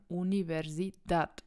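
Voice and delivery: "Universidad" with the final -dad stressed; stress on last syllable. Shown as a demonstration. Teacher showing the rule, last syllable of "Universidad" stressed. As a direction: build to a firm DAD in "Universidad". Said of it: "auf der letzten Wortsilbe betont".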